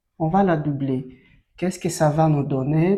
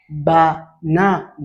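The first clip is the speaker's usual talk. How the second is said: "banane" slowly split as ba, na, ne; syllable by syllable; 4 dB louder than usual. Shown as a demonstration. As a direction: sound out "banane" in three separate beats. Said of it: "Ça nous donne le mot". (« banane » découpé lentement en ba, na, ne)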